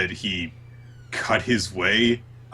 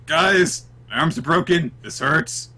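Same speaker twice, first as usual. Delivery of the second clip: pained protest; 8 semitones higher, 3 dB louder than usual. Retold or imitated. Acting Asta hurting in hug.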